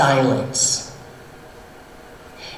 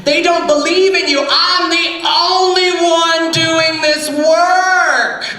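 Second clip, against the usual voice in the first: tantrum; 10 semitones higher than usual. Acted. Elijah ranting at the Holy Spirit in a high tantrum. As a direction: throw a whining tantrum at the Spirit. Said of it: "he threw a temper tantrum".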